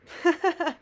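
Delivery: sudden high laugh; high burst of laughter. Real, not acted. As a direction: break into a bright laugh. Laughs at her own video.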